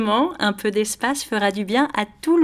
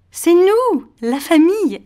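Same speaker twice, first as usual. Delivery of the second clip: higher, louder, emphatic answer; joyful reveal; 6 semitones higher, 7 dB louder, slower than usual. Acted. Mother telling her child what never changes, joyful. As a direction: announce it brightly like a happy surprise.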